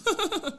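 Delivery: high, light burst of laughter; laughing. Real, not acted. Host forgetting the phone number on air, high laughing. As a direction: laugh at your own lapse.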